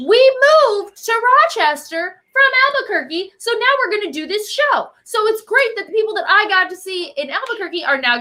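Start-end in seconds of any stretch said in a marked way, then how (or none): 0.1-4.9 high, bright retelling of a friend's news; imitating her buddy. Guest quotes a friend announcing her move, in a high put-on voice. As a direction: play the buddy sharing her news, pitched well up. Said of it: "my buddy hits me up, and she's like".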